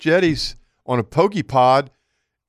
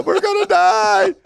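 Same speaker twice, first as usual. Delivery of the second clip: high, drawn-out comic wail; hammed-up cry of doom; mock panicked scream; 3 dB louder, slower than usual. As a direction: imitate two men screaming for their lives. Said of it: "we're both screaming at each other".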